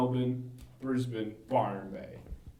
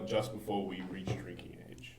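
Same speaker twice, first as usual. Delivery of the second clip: whispered, barely voiced confession; 6 semitones lower, 9 dB quieter than usual. Real, not acted. Admitting underage drinking to friends, whispered. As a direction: whisper it like a guilty secret.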